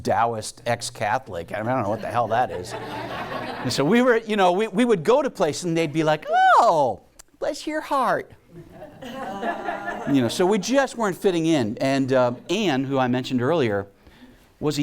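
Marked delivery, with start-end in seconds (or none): 2.7-6.3 laughing, higher mock church lady; mock sweet condescension, laughing; mock southern church lady; amused retelling. Church people patronizing. 6.6-8.3 high, slow, syrupy mock bless your heart; sweetly condescending church lady impression; high mock southern church lady. Church people patronizing. 9.0-11.8 laughing, higher mock church lady; mock sweet condescension, laughing; mock southern church lady; amused retelling. Church people patronizing.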